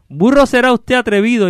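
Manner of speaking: high, indignant retort in verse; offended carter. Acted. Insulted carter answering the hidden voice, indignant. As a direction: throw the insult back theatrically.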